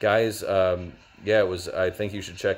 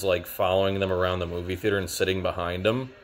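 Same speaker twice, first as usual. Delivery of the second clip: ordinary delivery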